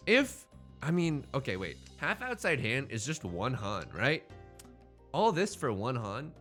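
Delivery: normal talking voice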